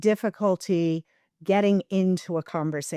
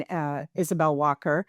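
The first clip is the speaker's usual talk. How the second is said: normal talking voice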